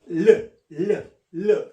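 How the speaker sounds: 르 said as a loud, separate "lleu"; tongue-touching L sound. Shown as a demonstration. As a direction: press the tongue to the gum ridge for each "르". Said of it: "이렇게".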